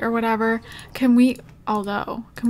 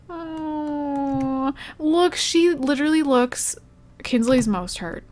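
normal talking voice

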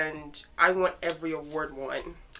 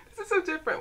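excited; 10 semitones higher, faster than usual. Real, not acted. Reacting to new photos.

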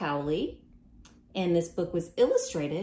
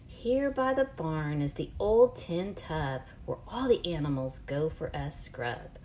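ordinary talk, nothing marked

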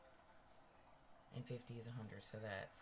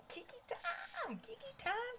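squealed much higher, slow and drawn out; high sing-song call. Pretend. Host calling viewers to her show, squealed sing-song. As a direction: sing out the show's catchphrase.

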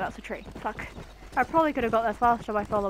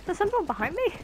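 higher, mildly spooked question; mock startled; 7 semitones higher than usual. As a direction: re-enact being a little scared. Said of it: "that zombie horse scared me a little bit".

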